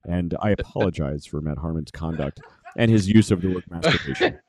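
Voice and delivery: very low, put-on disclaimer voice; mock-solemn spokesman disowning a co-host; mock official apology.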